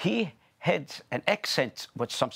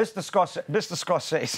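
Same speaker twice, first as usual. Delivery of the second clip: mock deference; 5 semitones higher than usual. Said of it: "You don't just say Marty like that".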